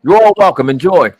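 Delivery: high, loud, confrontational monologue; heated street boss. Acted. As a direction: lay down the law, ending in a big loud welcome. Acted threat and takeover.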